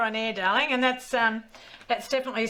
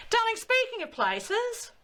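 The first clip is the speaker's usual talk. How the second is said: slow, much higher, theatrical darling; camp host drawl; 10 semitones higher, slower than usual. Acted.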